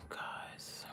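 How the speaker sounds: whisper. Real, not acted. Hushed aside to viewers.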